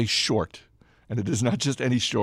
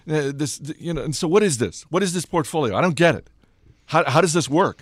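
higher, louder baffled questioning; mock bewilderment, stumbling into pitched-up questions; dumbfounded reporter; 6 semitones higher, 5 dB louder than usual. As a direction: imitate a reporter who is utterly baffled. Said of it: "some of them were flat out dumbfounded by this approach".

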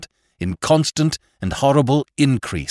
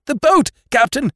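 high, loud frightened cry; shouting to the captain in fear, much higher and louder; 4 dB louder than usual. A narrator's reading. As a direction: yell it in panic, pitch way up. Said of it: "shouting in a queer, frightened voice".

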